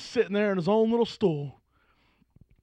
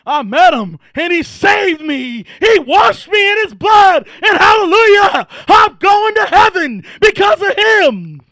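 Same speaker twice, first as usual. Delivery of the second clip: shouted, slow, high testimony; rising preacher's cry of praise; full-force preaching; 11 semitones higher, 12 dB louder, slower than usual. Acted. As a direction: lift it up to a hallelujah shout.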